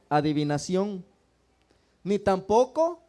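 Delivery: loud, measured Bible reading from the pulpit; scripture reading. Acted. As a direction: proclaim each verse to the whole church. Reads scripture aloud to the congregation. (lectura bíblica en voz alta y solemne)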